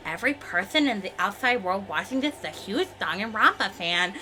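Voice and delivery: lightly voiced character with a lisp; Chiaki. Character describing the game's fans, soft and lisping. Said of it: "Try saying that with Chiaki's accent".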